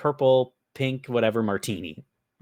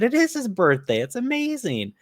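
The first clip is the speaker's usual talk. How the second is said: high, louder, delighted; excited reply; 13 semitones higher, 5 dB louder than usual. Retold or imitated. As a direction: gush about the birthday. Narrator's own reply, excited.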